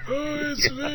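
high-pitched mock elderly voice; imitated old man. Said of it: "talking like an old man".

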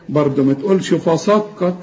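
ordinary delivery

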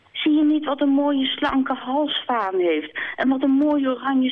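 the hen sighing over the swan's beauty; envious hen admiring the swan. Acted. Kip admiring Zwaan.

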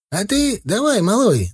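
high-pitched grandad calling up; old man's shout. Acted. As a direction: holler up to the kid like an old man. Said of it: "закричал дедок". (дедок кричит, высоким голосом)

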